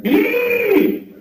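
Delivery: very high shrill yelp; crazy yelp. Retold or imitated. Stranger on the subway, a very high yelp. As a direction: let out a piercing high squeal. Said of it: "I hear this".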